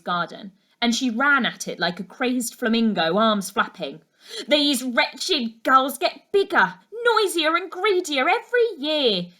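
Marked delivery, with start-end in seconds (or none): 4.5-9.3 loud, high complaint about the gulls; complaining. A narrator's reading. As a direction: grumble loudly at the greedy gulls. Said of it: "she complained".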